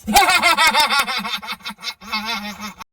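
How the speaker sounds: high manic cackle; psychotic laughing. Retold or imitated. Jake breaking under the news, manic laugh. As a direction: cackle like you've lost it. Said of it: "psychotic laughing".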